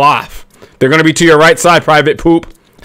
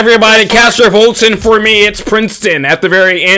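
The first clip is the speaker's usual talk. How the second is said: YouTuber intro; 8 semitones higher than usual. Acted. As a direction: hype up like a channel host.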